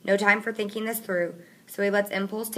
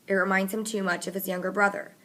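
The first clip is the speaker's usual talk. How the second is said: usual speaking voice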